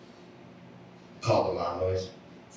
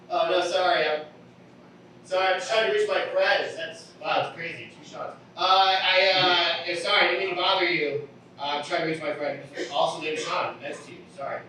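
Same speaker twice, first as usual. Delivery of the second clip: high-pitched, stumbling apology; flustered apologetic stranger; 9 semitones higher than usual. Acted. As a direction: apologise awkwardly in a higher voice.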